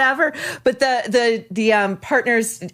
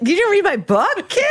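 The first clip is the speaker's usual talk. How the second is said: higher, scolding mother voice; mock scolding mom; 10 semitones higher than usual. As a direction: scold the kid in her voice.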